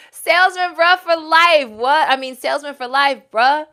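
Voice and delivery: loud, high playful reading; excited. Real, not acted. Host reads viewer's name out, loudly amused. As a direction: read the name with glee.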